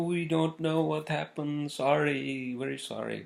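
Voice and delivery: high, drawn-out mock apology; apologizing laundry lady. Laundry lady apologizing to returning customer, high imitated apology. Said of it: "the ladies are apologizing".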